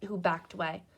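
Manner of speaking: usual speaking voice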